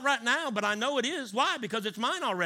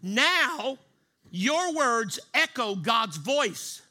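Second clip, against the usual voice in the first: slow, loud, stretched proclamation; slow emphasis; 3 semitones higher, 4 dB louder, much slower than usual. Shown as a demonstration. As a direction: lean on every word. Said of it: "Did you hear me?".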